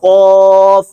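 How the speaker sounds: قاف drawn out very slowly from the back of the throat; Arabic qaf stretched out as a model; deep qaf. Shown as a demonstration. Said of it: "یہ نقطوں والا قاف".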